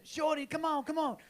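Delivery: high-pitched holler across a field; calling out to Shorty. Retold or imitated. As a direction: call him over in a put-on street voice. Acting out a call to Shorty.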